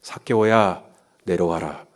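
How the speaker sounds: soft, low, tender call; gentle 예수. Acted. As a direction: call him down gently and quietly. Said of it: "예수님이 삭개오에게 뭐라 그래요". (나직하고 다정하게 부르는 말투)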